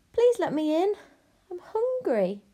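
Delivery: high, insistent plea; pleading princess. Acted. Princess knocks on the hut door, pleading. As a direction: call out high and beseeching. Lost princess begs again.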